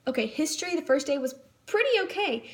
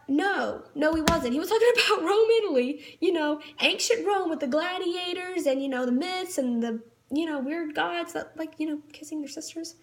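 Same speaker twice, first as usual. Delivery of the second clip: normal talking voice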